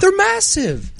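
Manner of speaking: high, loud squeal of disbelief; exaggerated disbelief. Real, not acted. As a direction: stretch it way up in mock outrage. Griping about huge shopping carts.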